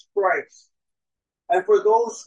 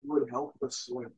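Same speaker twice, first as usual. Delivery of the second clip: low, soft, earnest prayer; 10 semitones lower, 6 dB quieter than usual. Real, not acted. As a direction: pray quietly to God, pitched low. Leads opening prayer.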